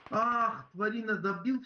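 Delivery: sudden high indignant cry; surprised outburst. Real, not acted. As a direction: blurt it out in disbelief. Teammate finished off. (возмущённое удивление)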